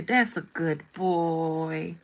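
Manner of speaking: slow, drawn-out pat-on-the-head praise; master praising dog. Acted. Master rewards his obedient servant like a dog, slow praise. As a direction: coo it slowly, as to a pet. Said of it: "And he say".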